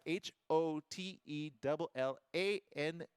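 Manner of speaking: letter by letter; careful letter-by-letter spelling; spelled out. Shown as a demonstration. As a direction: spell it out for listeners, one letter at a time. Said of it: "How do you spell it".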